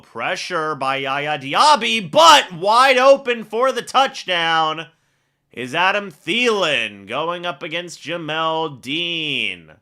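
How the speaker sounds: rising into a full-voiced touchdown call, then easing back. Real, not acted. Touchdown pass called live.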